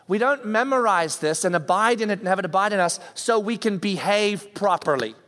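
usual speaking voice

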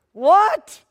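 high, loud disbelieving What; shocked what. Acted. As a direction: blurt it out in shock. Shocked reaction to sacrifice.

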